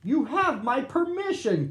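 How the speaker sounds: high, loud call over the noise; shouting. A narrator's reading. Gordon calling to Big Bird, high shout. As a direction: bellow it across the gap. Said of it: "Gordon said".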